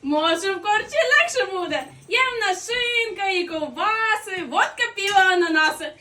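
shouted high and very loud; bellowing to draw in passers-by; loud shouting. Acted. Innkeeper calls people in.

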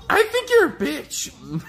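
high, slow put-on voice; squeaky insult voice. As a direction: drag out the insult in a silly high voice.

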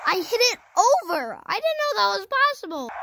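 high, loud disbelief; amazed excitement. Real, not acted. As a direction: burst out thrilled at a surprise success. Amazed his long kick went over.

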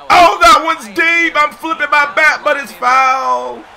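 loud, high burst of excitement; excited. Real, not acted. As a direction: yell it like the ball is gone. Excited reaction to deep hit.

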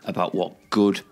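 loud, upbeat host announcement; podcast intro read. Acted. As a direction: sell the show like a radio host. Podcast intro read over music.

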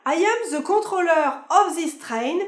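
put-on slow, high train announcement in heavily French-accented English; mock French-accented English, high and drawn out; bad French accent. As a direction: mimic a French conductor's poor English accent, word by laboured word. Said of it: "c'est l'accent. Ah non, ça en est toujours aussi mauvais".

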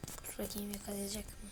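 soft, hushed check; hiding gamer whispering to himself; nervous whisper. Real, not acted. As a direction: whisper while holding your breath.

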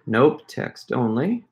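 ordinary talk

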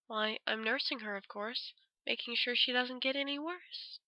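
a hint of menace under false calm; creepy Yuta.

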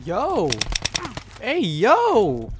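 high, loud, swooping exclamations; amazed. Real, not acted. Player telling Daniel about a new move, amazed. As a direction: stretch the words in rising astonishment.

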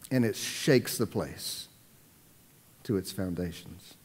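hushed and slow. Acted. Dramatic hushed slow emphasis.

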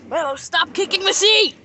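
sharp scolding yell; loud. Real, not acted. Parent scolding kid in car.

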